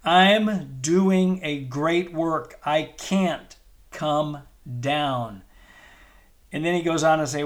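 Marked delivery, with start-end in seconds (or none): 0.0-5.4 slow, firm, each word weighted for the crowd to echo; slow call and response. Shown as a demonstration. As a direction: lead the congregation, word by word. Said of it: "Just say it with me".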